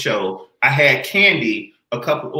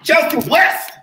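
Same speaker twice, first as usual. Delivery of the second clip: hype announcer; 12 semitones higher than usual. Acted. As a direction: shout the name like a host. Host hypes arriving guest.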